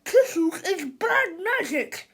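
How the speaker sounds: high, loud, slow brute cry; frightened monster-speak. A narrator's reading. Melvin warning everyone, frightened brute. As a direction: wail a superstition. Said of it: "screamed Melvin".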